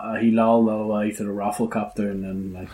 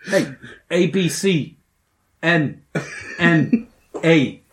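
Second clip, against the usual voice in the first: future abbreviated speech; 5 semitones higher, much slower than usual. Pretend.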